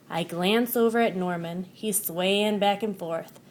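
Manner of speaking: ordinary delivery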